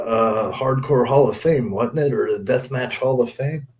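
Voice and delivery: normal talking voice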